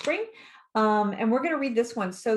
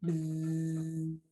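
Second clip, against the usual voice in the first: low, sustained buzzing noise; long steady bzzz; bee buzz; 3 semitones lower, 5 dB quieter than usual. A narrator's reading. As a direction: imitate a droning bee. Imitating the bees' buzzing.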